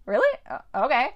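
a sudden high surprised "Really?" then a doubtful okay; skeptical really. Retold or imitated. Imitating Dan doubting Amenadiel's police plan, high and surprised. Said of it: "Dan's kinda like".

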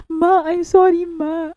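high, loud hammed-up sorry; tearful apology recited. Fan reciting the daughter's apology to her mother, pleading. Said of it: "kayang-kaya ko itong, ano, i-recite".

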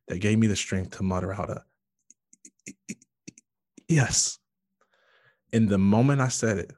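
ordinary talk

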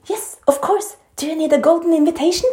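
imitated exasperated teacher, pitched well up; high, impatient sarcasm, half joking; mock irritated. Teacher to student stuck in shoulderstand, mock irritated. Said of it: "han ble litt sånn irritert".

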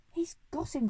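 high-pitched triumphant cry; excited cry. A narrator's reading. Monkey announcing the burglar's capture, excited cry. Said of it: "cried the monkey".

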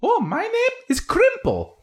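very high, bright little bonsai creature; chipper little voice. Acted. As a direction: squeak it out like a tiny eager plant person. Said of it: "Crimple introduces himself".

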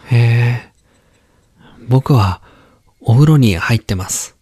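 put-on brighter childlike boy tone; young boy voice. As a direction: chat like a little boy. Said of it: "男の子".

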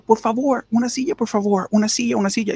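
imitating the man shouting for a seat; mock yelling beg. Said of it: "el man empezó a gritar".

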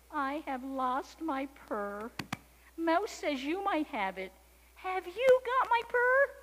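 high, drawn-out kitten voice; slow, high plea rising to a question; discouraged, tired kitten. A narrator's reading. Tired kitten asks the sheep, high, slow and pleading. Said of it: "A little discouraged, very tired".

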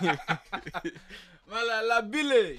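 high laughing mock name; laughing mock French.

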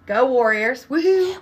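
loud cheer rising to a high woohoo; team cheer. Real, not acted. Proud mom cheering her son's football team, excited.

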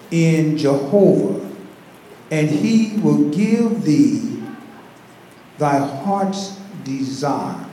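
very slow, deliberate scripture reading; weighty, drawn-out verse; scripture recitation. Real, not acted. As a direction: recite it phrase by phrase with long pauses.

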